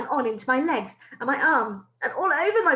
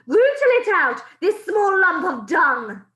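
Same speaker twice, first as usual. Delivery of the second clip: high, loud, slow screech; a witch screeching about a hidden child; 7 semitones higher, 9 dB louder, slower than usual. Acted. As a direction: spit the insult out in a screech. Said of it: "screeched the Grand High Witch".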